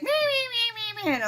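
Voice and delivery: high nasal-free whiny meh meh, way up in pitch; mock a whining kid, high and sing-song; whiny mimic. Mom mocking her whining son, high whiny meh. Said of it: "I'll be like".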